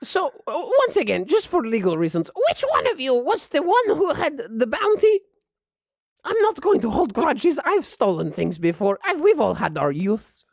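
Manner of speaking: high-pitched granny questioning her guests; high old-lady character voice; bossy old grandma. Acted. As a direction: interrogate them as an old woman in charge. Game master plays grandma questioning guests.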